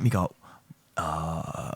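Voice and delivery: ordinary talk, nothing marked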